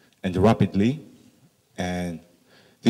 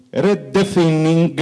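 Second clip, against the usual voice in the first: redefining said the Italian way, re-de-fi-ning, spelled out as written, higher and slower; Italian accent; 7 semitones higher, 4 dB louder, slower than usual. Retold or imitated. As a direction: read redefining letter by letter like an Italian, with a hard g at the end. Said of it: "Italians would say".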